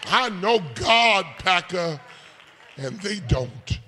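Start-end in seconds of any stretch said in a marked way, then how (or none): 0.1-2.0 high, loud sung whoop over the music; preaching. Acted. Preacher calling the congregation to holler, sung whoop. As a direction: hold the notes and shout the claim.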